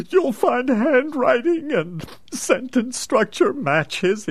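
high, hammed-up tearful voice; fake grief. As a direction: put on a mournful act.

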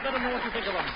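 normal talking voice

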